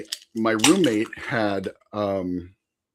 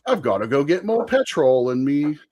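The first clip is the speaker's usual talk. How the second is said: mock British accent with slang; 4 semitones higher than usual. Storyteller bluffs British slang about getting gas, fake British accent. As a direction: lean into a hammy British accent. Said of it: "trying to keep up with, like, British slang".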